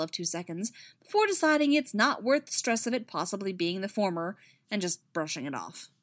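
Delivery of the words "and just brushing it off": plain reading voice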